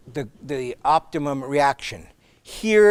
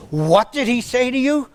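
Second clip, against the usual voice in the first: high, indignant colonel's question; mock-indignant high pitched colonel; imitating outraged colonel; 8 semitones higher, 3 dB louder than usual.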